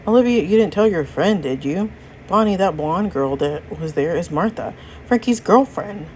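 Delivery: usual speaking voice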